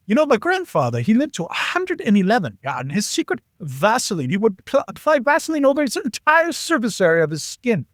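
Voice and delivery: high-pitched put-on German accent. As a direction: imitate a German buddy telling a family secret. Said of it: "Still working on my German accent".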